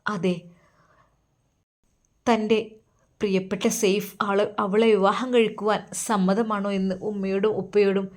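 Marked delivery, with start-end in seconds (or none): none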